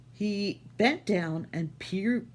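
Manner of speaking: ordinary delivery, nothing marked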